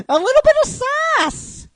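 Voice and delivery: very high, loud sing-out; piercingly high, playful outburst; sassy squeal. Acted. Comments on Pikachu's sassy outburst.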